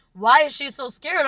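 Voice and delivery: loud, high, slow bewildered question; retold alarm. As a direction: act out a child's alarmed confusion. Acts child's alarm at teacher's panic.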